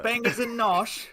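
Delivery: high, slow put-on British; slow, high hammed-up British accent; mock British accent. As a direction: savour it like a cartoon Brit. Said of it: "your impersonation of their slang".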